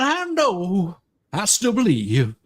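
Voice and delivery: usual speaking voice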